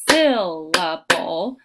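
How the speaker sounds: SYLLABLE slowly split, SYL loud and stretched; stress on first syllable. Shown as a demonstration. Teacher helps students hear stress, exaggerated SYL in syllable. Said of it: "they would hear that stress on the first syllable".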